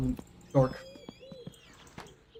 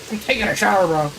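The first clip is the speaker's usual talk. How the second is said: higher, louder, calling to someone away from the mic; loud call to a roommate, higher and louder; 6 semitones higher, 5 dB louder, faster than usual. Real, not acted. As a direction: shout it across the room to a friend.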